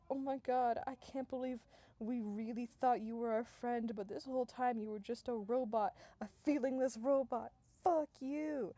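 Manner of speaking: hammed-up wounded accusation; acted betrayal. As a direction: hurl it at a friend who betrayed you. Betrayed boys confront the robot.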